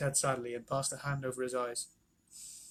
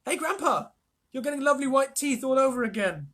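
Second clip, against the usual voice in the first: put-on high, loud cheerful boy; boy delighted at grandpa; 10 semitones higher, 7 dB louder than usual. A narrator's reading. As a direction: tease him with glee.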